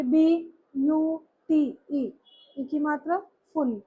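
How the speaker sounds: slow, separated "बी यू टी ई"; syllable by syllable. Shown as a demonstration. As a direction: sound out "बी... यू... टी... ई" one beat each. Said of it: "अब हम इसको pronounce करते हैं".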